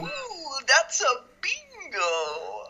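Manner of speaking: high, slowly drawn-out "bingo"; hammed-up delight, stretching "bingo" out; gleeful bingo. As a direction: savour "bingo" in a sing-song high voice. Quoting the film's exaggerated bingo line.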